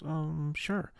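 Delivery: high, hesitant drawn-out agreement; reluctant, wary yes in a high voice; mock trepidation. Bandleader agreeing to let him introduce it, with trepidation. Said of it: "with a little bit of trepidation in his voice".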